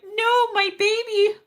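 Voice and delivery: high, slow anguished cry; dramatic wail. Retold or imitated. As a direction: wail it like a frantic parent. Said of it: "And I'm like".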